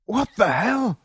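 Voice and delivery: high, sharp cry of fright; frightened scream. Acted. Jolin seeing the zombie, terrified scream. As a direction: scream it at the sight of the monster. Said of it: "screamed Jolin".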